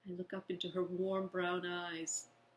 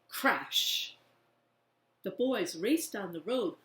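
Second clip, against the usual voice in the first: ordinary delivery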